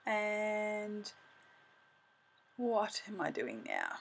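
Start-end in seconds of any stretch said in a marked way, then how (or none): none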